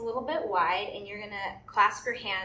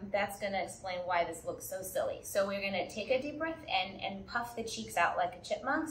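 ordinary talk, nothing marked

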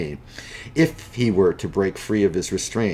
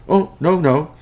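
nervous denial; 9 semitones higher, 7 dB louder than usual. Acted. Macy nervously denies.

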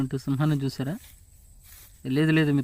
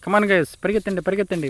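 higher, louder urgent call to run; 6 semitones higher, 4 dB louder than usual. Acted. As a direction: hurry everyone along. Urging group to run.

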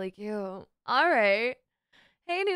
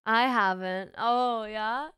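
ordinary talk

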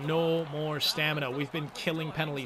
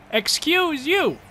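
indignant; 10 semitones higher, 6 dB louder, much slower than usual. Real, not acted. Indignant reply to the game.

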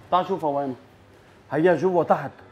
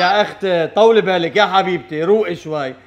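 low, soft calming voice; soothing; 7 semitones lower, 5 dB quieter than usual. Acted. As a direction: gently settle an upset sister down. Soothes his upset sister on phone.

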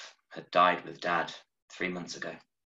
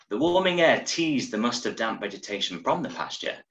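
plain reading voice

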